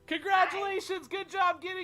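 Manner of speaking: high, loud cheering shout. Shown as a demonstration. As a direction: yell it across the room. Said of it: "say something loud again".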